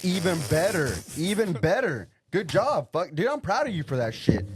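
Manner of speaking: loud, high praise; excited praise. Real, not acted. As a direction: hype him up loudly. Enthusiastic praise to Braden.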